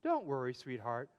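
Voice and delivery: a soft, gentle mother soothing her son; tender mother. A narrator's reading. Mother tucking her son into bed, softly reassuring. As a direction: soothe him quietly like a mom at bedtime. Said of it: "smiled at him and said".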